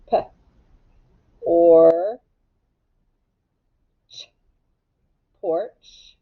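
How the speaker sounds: "P", "or", "ch" sounded out one by one, then blended into "porch". Shown as a demonstration. Teacher modelling sound-by-sound blending for the class, slow and segmented. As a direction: stretch each sound, then blend the word. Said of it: "sounding out a word".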